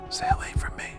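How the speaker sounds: weak, creaky, breathless whisper. Acted. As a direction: barely get the words out. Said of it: "My words come out in little more than a creaky whisper".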